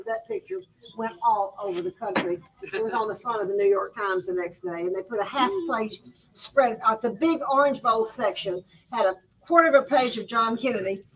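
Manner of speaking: ordinary talk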